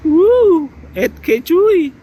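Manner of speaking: loud, high thrilled exclaim; excited. Real, not acted. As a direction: whoop with delight. Impressed by 8K recording.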